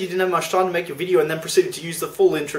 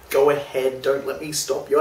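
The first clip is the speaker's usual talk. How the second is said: ordinary delivery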